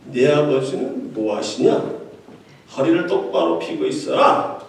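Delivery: lightly voiced arrogant heir, pitched low; retold rude talk. Chairman's son talking down to new lawyers, mock condescending. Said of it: "이렇게 막말을 하지 않나".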